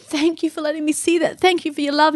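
retold prayer, moved and high; tearful thanks. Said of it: "he just wept, and he said".

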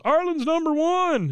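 very high, slow rally cry; high slow cheer. Retold or imitated. Bill at the pep rally, in a high, slow cheer. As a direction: stretch it out like a weak cheer. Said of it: "he goes".

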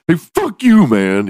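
hammed-up high yell; mock indignant shout. Imagined friend shouting back at a teasing bully. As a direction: pretend to snap back loudly. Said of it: "they never were like".

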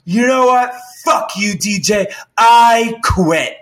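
loud, high angry outburst; retold yelling. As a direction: yell it and storm out. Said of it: "this yelling went on".

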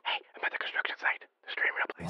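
hushed whisper into a phone. Acted. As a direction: whisper so nobody overhears. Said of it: "Why are you whispering?".

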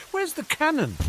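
high-pitched startled question; startled Dudley. Acted. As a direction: cry out in a high voice, jolted awake. Dudley woken by door banging.

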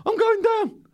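high panicked yelp; mock panic. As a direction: squeal as if slipping off the fence. Nearly falling off fence.

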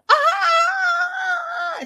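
long, loud high-pitched shriek; mock scream. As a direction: imitate a sustained scream of panic. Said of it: "Still screaming".

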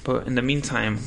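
normal talking voice

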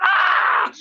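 high, sharp mock grunt, unvoiced; a sudden strained cry as he grabs; mock shout. Imitating Gotch grabbing a neck, a high mock shout. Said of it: "he's like".

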